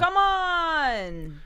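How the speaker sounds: long drawn-out rallying yell; excited cheer. Real, not acted. Watching the live football match, excited drawn-out cheer. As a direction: stretch it out like a fan urging the team on.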